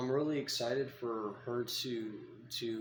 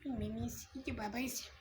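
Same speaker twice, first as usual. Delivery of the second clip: much higher, softer sing-song goodbye; 13 semitones higher, 4 dB quieter than usual. Real, not acted. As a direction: chirp a cutesy bye-bye to the pet. Baby talk to his snake.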